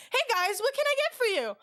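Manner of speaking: high, chirpy server voice; customer-service greeting. Retold or imitated. Retold greeting to customers at window.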